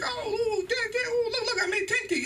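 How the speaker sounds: put-on a proud toddler showing off; excited little child.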